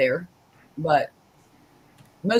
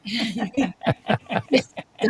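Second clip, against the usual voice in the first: wild rambling cackles; 4 semitones higher than usual. Real, not acted. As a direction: burst out cackling. Laughing at Tom's mock accent.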